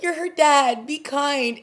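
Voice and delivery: high and loud plea; pleading at the screen. Pretend. To Rowan on screen, a high loud plea. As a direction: beg him to be kind to her. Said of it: "I was like".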